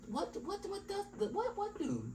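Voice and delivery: very high, stammering confusion; baffled customer. Acted. Customer thrown by the rugged greeting, baffled. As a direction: squeak out confused whats.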